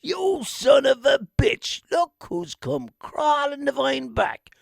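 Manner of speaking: high, slow mock wail; hoodie cry-shout. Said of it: "He was like".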